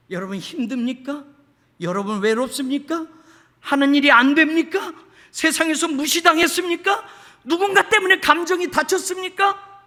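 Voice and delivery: questions building to a high shout; rising, loud pulpit appeal; impassioned preaching. Real, not acted. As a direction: press each question harder on the congregation. Preacher builds rhetorical questions to full force.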